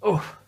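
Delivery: a sharp high oof of effort; strained oof. A narrator's reading. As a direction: push out a high, loud oof as if flattened. Douglas strains lifting.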